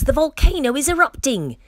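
high, urgent storybook cry; alarmed, high-pitched warning. A narrator's reading. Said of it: "cried the friends".

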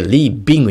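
"leaving" turned into "leebing", with a B; half-hearted V. Shown as a demonstration. Coach showing the slip, "leaving" said as "leebing". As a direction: close the lips mid-"leaving" to make a B. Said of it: "もしこれが例えば中途半端になって".

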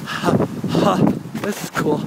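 normal talking voice

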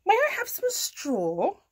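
high rising request; cat asking. A narrator's reading. Cat asking a farm animal for things, high cat voice. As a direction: ask politely in a lifted cat voice. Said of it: "said Kat".

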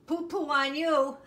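loud, high taunt breaking into laughter; cheeky Polly. Acted. Baby sister Polly answers the family, cheeky laughing taunt. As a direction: blurt it out high and giggle.

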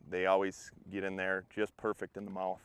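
normal talking voice

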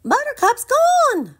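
very high, loud cry of alarm; alarmed shout. Acted. Little Dude alerting everyone, high alarmed shout. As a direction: shout the news in a panic. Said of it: "Little Dude shouted".

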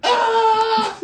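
high shrieking cry with a laugh in it; loud mocking wail; mock scream. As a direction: shriek it like a kid in pain. Imitating the kid screaming.

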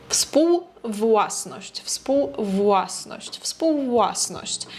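współwłasność drawn out very slowly. Shown as a demonstration. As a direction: stretch współwłasność out so learners hear wu and eu. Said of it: "так протягується". (дуже повільно, протяжно)

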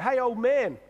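put-on loud, high cheeky greeting to an old dad; mock disrespectful son calling out. Son cheekily hails his father, loud and high.

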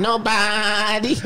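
slow bleating nobody; sheep voice. Pretend. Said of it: "Let me put it in the sheep voice".

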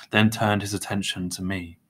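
ordinary delivery, nothing marked

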